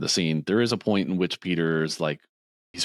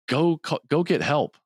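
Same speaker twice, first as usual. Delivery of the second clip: high-pitched retold cry for help; mock urgent plea; 9 semitones higher than usual. Injured surfer sends his friend for help, mock urgent plea. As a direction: call for help in a raised pitch.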